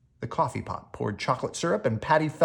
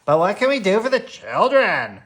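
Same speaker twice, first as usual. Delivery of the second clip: put-on higher, louder young voice; eager brother; 7 semitones higher, 7 dB louder than usual. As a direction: ask urgently like a boy. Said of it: "asked Brother John".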